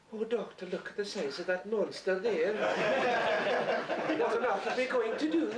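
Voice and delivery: high, laughing Highland lady's mock alarm; a hammed-up frightened Highland companion; Highland accent companion. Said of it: "people would be so bored with".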